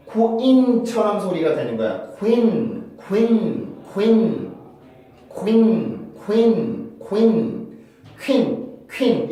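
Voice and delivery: "queen" as ku-IN, stress pushed onto the long vowel. Shown as a demonstration. Teacher models queen for students, stressed second sound. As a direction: glide from a short ku into a strong, falling IN in "queen". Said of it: "여기에서 내가 힘을 빡 줘야 되기 때문에".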